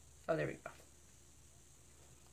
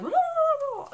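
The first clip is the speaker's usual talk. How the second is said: mock jittery whoa; 6 dB louder than usual. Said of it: "I had way too much coffee".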